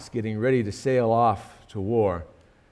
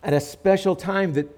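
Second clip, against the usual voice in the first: higher and louder, fervent preaching; preaching at full force; 6 semitones higher, 6 dB louder than usual. Acted. Preacher stirring the congregation about the war, full force. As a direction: build the sermon to a ringing peak.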